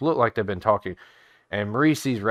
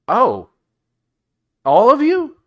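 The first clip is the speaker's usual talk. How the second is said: much higher, louder astonished question; shocked; 14 semitones higher, 11 dB louder, slower than usual. Acted. Innkeeper Raz seeing the laminated paper, astonished. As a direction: jump up in disbelief.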